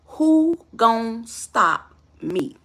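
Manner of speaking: drawn out word by word, daring him; slow defiant challenge. Retold or imitated. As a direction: imitate a girlfriend's very slow, deliberate dare. Acted girlfriend's challenge, slowed for effect.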